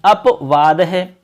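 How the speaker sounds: अपवाद spelled out slowly, अप sound drawn out; teacher's slow model reading of अपवाद; slow clear pronunciation. Shown as a demonstration. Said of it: "अप की ध्वनि आएगी".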